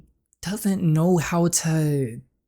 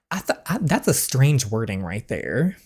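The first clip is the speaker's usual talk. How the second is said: ordinary talk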